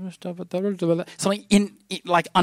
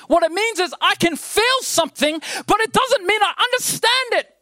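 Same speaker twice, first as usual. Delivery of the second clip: higher, rising preacher's call to the church; preaching at full force; 12 semitones higher than usual. Real, not acted. Pastor preaching to the brothers and sisters, rising to full force. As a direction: build the sermon up to a ringing peak.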